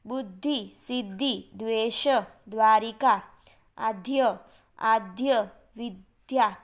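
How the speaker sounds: one word at a time, syllables held apart; careful word modelling. Shown as a demonstration. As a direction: pronounce each word deliberately for pupils to copy. Teacher repeats word list for pupils.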